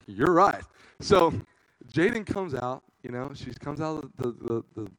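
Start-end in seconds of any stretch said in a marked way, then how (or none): 0.1-0.6 loud cocky confirmation; smug reply. Retold or imitated. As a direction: boast it back at her. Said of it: "I was like".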